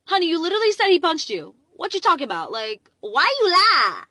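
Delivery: loud, high, mock-scolding call-out; incredulous retort. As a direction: call her out loudly, stretching the last words. Acted incredulous retort to the neighbour.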